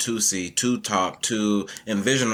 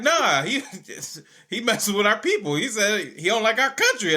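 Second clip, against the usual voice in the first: much higher pitch, laughing through the retelling; girl's indignant protest; 12 semitones higher than usual. Retold or imitated. Quoting the refugee student defending herself, high indignant protest. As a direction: imitate an insistent girl, high and amused.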